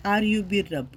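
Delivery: usual speaking voice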